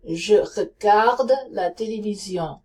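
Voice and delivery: sentence in French with a throaty R; French accent. Shown as a demonstration. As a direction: read "Je regarde la télévision" in French. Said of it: "Now say regardez".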